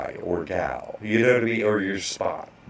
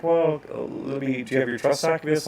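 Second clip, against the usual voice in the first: higher, quick chirpy clerk; bank lady; 6 semitones higher than usual. Retold or imitated. Bank clerk asking for trust documents, higher and quick. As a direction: rattle it off like a cheerful clerk. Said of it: "she was like".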